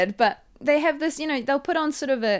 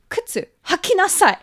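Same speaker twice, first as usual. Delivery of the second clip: higher, louder imitated telling-off; mock scolding; 7 semitones higher, 5 dB louder than usual. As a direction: snap it like a scolding stranger. Retold stranger scolding her in Japanese.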